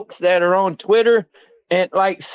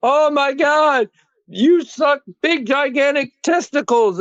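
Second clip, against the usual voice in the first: mock whiny fan, higher and slow; mocking Twitter fan; 8 semitones higher than usual. Mocking angry Jaguars fans on Twitter, higher and slow. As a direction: drag it out like an overdramatic fan. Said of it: "go on Twitter and go".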